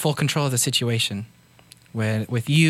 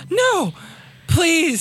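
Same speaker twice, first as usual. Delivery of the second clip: gasping plea. Acted. Gasps at threat of violence.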